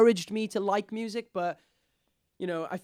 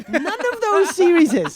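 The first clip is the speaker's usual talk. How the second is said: laughing exclamation; 13 semitones higher, 11 dB louder than usual. Real, not acted. Laughing outburst about anime.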